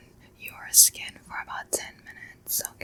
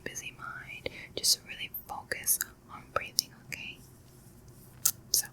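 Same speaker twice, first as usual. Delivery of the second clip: guided breathing; 3 semitones lower, 4 dB quieter than usual. Acted. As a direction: coax them through each breath, unhurried.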